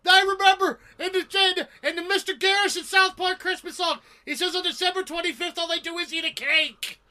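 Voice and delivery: very high, squeaky insistence; excited protest. Real, not acted. Co-host defending a wrong answer from South Park, excited protest. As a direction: argue back in a strained high pitch.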